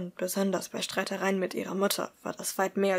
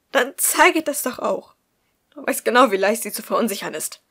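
heated retort; 2 semitones higher, 9 dB louder than usual. Acted. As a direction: push back hard. Tobias argues back. (Tobias hält laut und höher dagegen)

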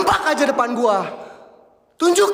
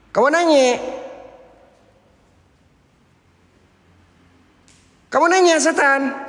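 mocking meme line; 8 semitones higher than usual.

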